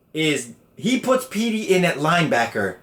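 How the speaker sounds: usual speaking voice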